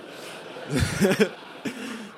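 a real high burst of laughter. Real, not acted. Laughing at not knowing eggs Benedict, a real high laugh. Laughs at his own joke.

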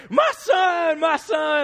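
preacher hugging his own son, shouting with joy; joyful cry. Retold or imitated.

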